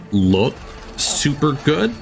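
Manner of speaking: normal talking voice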